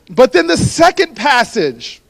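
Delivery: usual speaking voice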